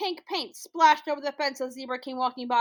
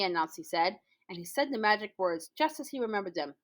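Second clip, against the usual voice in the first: ordinary delivery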